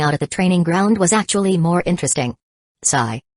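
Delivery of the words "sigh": a touch of heavy-hearted gloom, lower in pitch; mildly gloomy sigh. A narrator's reading. Narrator voicing Hugus Shu's regretful sigh, mildly gloomy. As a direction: say "sigh" low, with understated gloom. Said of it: "sighed heavily with a gloomy mood".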